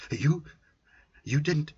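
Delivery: can't believe she did it; startled, voice jumps up; shocked accusation. Acted.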